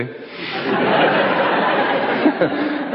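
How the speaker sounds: quiet airy chuckle at himself; real amused laugh under the breath; soft breathy laugh. Real, not acted. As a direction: let out a helpless breathy laugh. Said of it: "I had no plans for that one".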